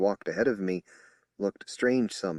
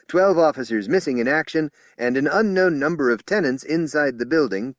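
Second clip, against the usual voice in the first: higher and louder official report; strident briefing; 6 semitones higher, 7 dB louder than usual. Acted. Spokesman reading casualty report to officers, loud and official. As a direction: rattle off the facts like a press statement.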